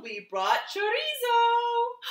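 high, slow squeaky mouse chorus; piping mice announcing their friend; tiny mice voices. Acted. Mice proudly presenting Chorizo to Chato, high and squeaky.

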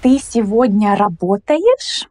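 ordinary talk

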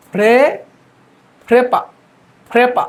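ফ্রেপা in isolation, aspirated start; modelled syllables. Shown as a demonstration. Teacher models for students to repeat, ফ্রেপা set apart by pauses. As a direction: give ফ্রেপা as a separate crisp chunk. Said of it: "দেখেন, বলেন".

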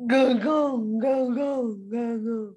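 big, wide-mouthed croaking; wide frog croak. Acted. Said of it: "opened her mouth wide and croaked".

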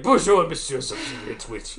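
light mock French accent; a hint of snooty French accent. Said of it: "French are stereotypical jerks".

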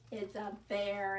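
ordinary delivery, nothing marked

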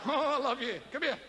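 lightly voiced leering shepherd with bleats; Scottish shepherd. Shepherd coaxing his sheep, lightly voiced leer. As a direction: coax the sheep like a lusty shepherd.

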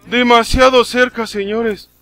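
high, loud and keyed up; excited. Real, not acted. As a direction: still rattled, telling the chat. Tras el sobresalto. (emocionado y agitado)

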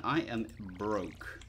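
ordinary talk

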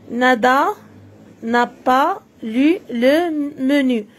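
"n'a pas lu le menu" read slowly, word by word; teacher reading the sentence slowly aloud; slow model reading. Shown as a demonstration. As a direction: read it slowly for children to follow. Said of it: "J'observe et je lis".

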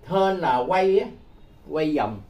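normal talking voice

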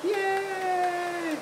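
high, drawn-out yay; squealed baby-talk cheer; playful cheer. Pretend. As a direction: cheer a little one on, very high. Cheering for the child to clap.

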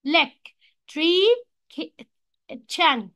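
"electrician" broken very slowly as e... lec... tri... cian; split into syllables. Shown as a demonstration. Teacher shows four syllables, "electrician" split in four. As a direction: say "electrician" in four separate beats. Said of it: "four syllables اللي هي الكلمة".

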